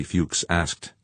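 ordinary delivery, nothing marked

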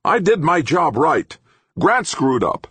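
high, loud blame-shifting; insistent. A narrator's reading. Engineer accusing a colleague of screwing up, hotly insistent. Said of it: "Obermann insisted".

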